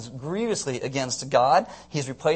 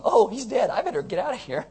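higher-pitched burst of comic panic; mock alarm; 6 semitones higher than usual. King voiced fleeing to Jerusalem, mock alarm. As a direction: play a mildly panicked getaway, easing off.

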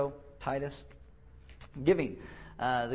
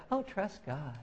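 higher, softly sweet reassurance; mock serenity; 6 semitones higher, 4 dB quieter, slower than usual. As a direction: imitate an irritatingly untroubled believer. Imitates serene believer.

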